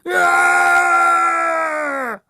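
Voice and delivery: sustained high, loud scream; long terrified scream. A narrator's reading. Boy fleeing the cinema, screaming. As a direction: scream at full pitch, holding it. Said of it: "ran screaming from the cinema".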